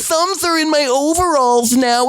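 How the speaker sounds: put-on high, cutesy child voice; little girl voice. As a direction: show off like a sweet kid. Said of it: "you're not gonna do the baby voice".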